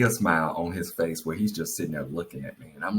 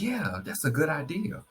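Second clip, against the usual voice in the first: higher-pitched, lightly voiced husband; imitating Charles; 8 semitones higher than usual. Wife mimics husband going along with recliner idea, imitating him. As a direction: play up his easy agreement, pitched higher. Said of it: "this is Charles. This how he do".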